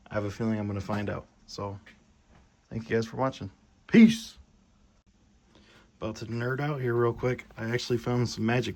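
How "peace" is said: high, loud shout; loud sign-off. Real, not acted. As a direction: belt out the goodbye. Sign-off to viewers.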